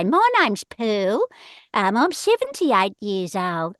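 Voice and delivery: put-on slow old lady voice; drawn out like a sweet elderly woman; Pearl voice. Shown as a demonstration. Old Pearl introducing herself to a radio station, slow. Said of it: "this is my Pearl voice".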